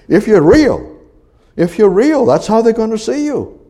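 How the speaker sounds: loud, high preacher's cry; high, forceful sermon, pushing every phrase; full-force preaching. Real, not acted. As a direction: hammer it home to the congregation.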